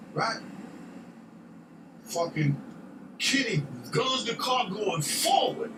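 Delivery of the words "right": normal talking voice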